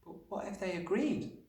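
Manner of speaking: put-on high little girl's hopeful question; small, eager child's voice; eager cry. A narrator's reading. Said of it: "Matilda cried eagerly".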